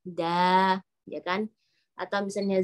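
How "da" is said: "da" with a light a vowel; flat-tongue da. Shown as a demonstration. Teacher repeats the correct light dal, thin da. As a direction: keep "da" thin, tongue low. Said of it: "lidah kita datar".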